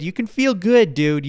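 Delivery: high, loud cheer of feel good; excited pep talk. Real, not acted. Podcaster urging his listeners, excited and loud.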